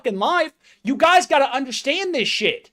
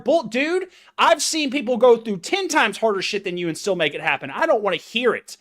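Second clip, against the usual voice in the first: normal talking voice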